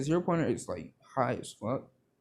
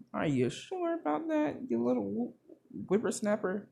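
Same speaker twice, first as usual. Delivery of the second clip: cranky granny; 9 semitones higher than usual. Acted. Recovery Girl grabbing Deku by the arm, in a high old-granny voice. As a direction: scold like a sly old granny.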